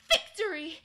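loud triumphant cry; sister's cry. Acted. The sister at the stairs, loud triumphant shout. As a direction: shout the win. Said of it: "and said".

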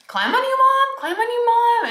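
put-on very high sing-song toddler voice; imitating toddler son. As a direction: play the giddy little boy climbing on mom.

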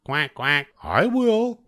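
a duck keen to eat. A narrator's reading. Duck eagerly volunteering to eat, high voice. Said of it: "said the duck".

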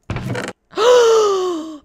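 loud, high gasp; huge gasp of thrilled shock; astonished gasp. Real, not acted. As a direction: gasp hard in amazement. Amazed at chest contents.

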